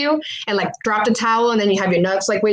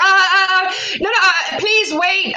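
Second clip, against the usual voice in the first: high, flustered plea to wait; retold panic; 9 semitones higher than usual. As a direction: re-enact stopping someone at the door in panic. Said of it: "I'm in such a panic".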